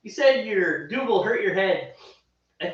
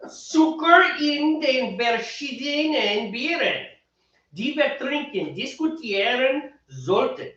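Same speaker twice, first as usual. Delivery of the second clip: slow German read with a Mexican-sounding "tieren", higher pitch; German read with Mexican accent; 6 semitones higher, slower than usual. Shown as a demonstration. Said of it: "That was brilliant German reading".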